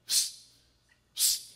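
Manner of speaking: short voiceless attention-getting hiss; frog's psst. Acted. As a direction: sneak a little "psst" to someone nearby. Frog calls from the pocket.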